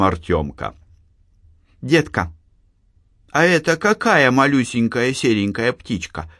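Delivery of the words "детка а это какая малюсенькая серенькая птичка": put-on curious child. A narrator's reading. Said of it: "спрашивал он".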